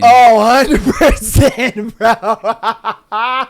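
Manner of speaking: slow, drawn-out agreement breaking into laughs; laughing agreement. Real, not acted. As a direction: agree while cracking up at the joke. Cracking up at co-host's joke.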